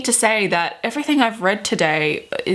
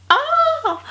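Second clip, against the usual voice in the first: very high shriek of glee; excited shriek. Real, not acted. Vlogger revealing the book behind, squealing high.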